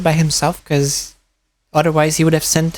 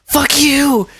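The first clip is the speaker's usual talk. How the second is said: high mock yell of a caught scammer; angry scammer yell; 10 semitones higher than usual. Scammer curses the narrator on the phone, high mock yell. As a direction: fake a furious scammer's high-pitched shout. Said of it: "The guy was like".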